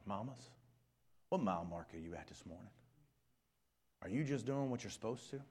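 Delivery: ordinary talk, nothing marked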